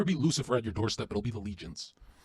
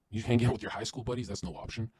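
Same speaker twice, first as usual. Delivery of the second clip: usual speaking voice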